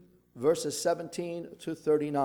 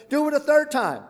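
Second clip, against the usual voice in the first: commanding; 9 semitones higher, 8 dB louder than usual. A narrator's reading. Elijah commanding, rising intensity.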